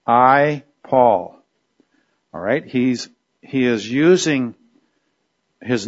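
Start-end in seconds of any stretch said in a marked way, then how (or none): 0.0-1.4 I and Paul set apart, each stressed; emphatic. Acted. Teacher quoting Paul to show his emphasis, emphatic. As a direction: land firmly on I, then on Paul. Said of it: "how emphatic he is here".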